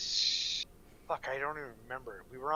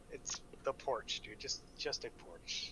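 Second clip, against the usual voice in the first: hushed exasperation; 7 dB quieter than usual. Real, not acted.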